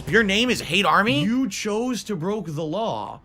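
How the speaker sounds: loud mock scolding proclamation; mock stern rant. Streamer opens mock-scolding his haters, loud and stern. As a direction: rant at the viewers like a stern general.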